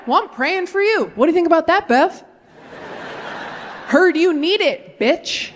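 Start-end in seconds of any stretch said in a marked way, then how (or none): 0.1-2.2 high, taunting retort; sassy comeback. Retold or imitated. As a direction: throw it right back, pitched up. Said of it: "Oh, yeah?".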